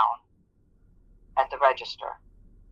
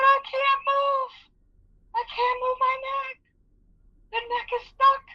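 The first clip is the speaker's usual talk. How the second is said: crying in pain. Retold or imitated. Injured cashier tells her supervisor she can't move, sobbing. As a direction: sob it out, stuck and scared. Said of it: "I was crying".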